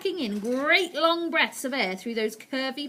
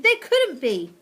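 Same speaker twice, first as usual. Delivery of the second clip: shouting; 5 semitones higher, 10 dB louder, slower than usual. Acted. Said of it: "the others shouted".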